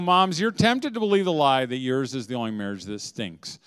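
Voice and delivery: normal talking voice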